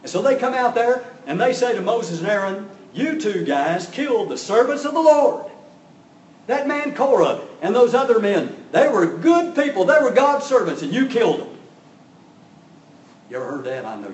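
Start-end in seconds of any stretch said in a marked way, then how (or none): 2.9-11.6 loud, higher, accusing shout; angry accusation. Retold or imitated. Mad congregation accusing Moses and Aaron, mock angry shout. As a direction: hurl the blame at Moses like an angry crowd. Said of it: "And they're mad".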